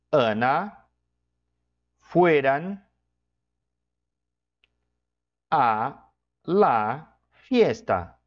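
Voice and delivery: Rodrigo e Ana fueran a la fiesta, word by word, slowly; slow dictation. Shown as a demonstration. Teacher dictating an example sentence, very slow. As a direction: dictate it slowly with long pauses. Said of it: "eu vou colocar assim".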